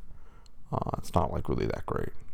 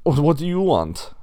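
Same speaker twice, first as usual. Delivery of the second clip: raised, louder pig voice; calling through door; 7 semitones higher, 8 dB louder than usual. Acted. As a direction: call out warily to an unwanted visitor. Pig calls through a closed door.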